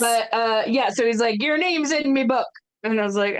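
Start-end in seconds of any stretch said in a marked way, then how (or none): 1.4-2.5 put-on terrible Irish accent. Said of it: "there's gonna be a lot of terrible Irish accents".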